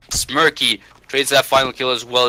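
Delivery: ordinary talk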